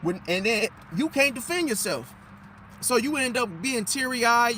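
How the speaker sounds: ordinary talk, nothing marked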